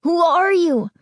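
put-on high, scared boy's voice; boy crying out in alarm; frightened cry. A narrator's reading. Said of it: "the other cried".

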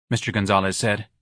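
ordinary delivery, nothing marked

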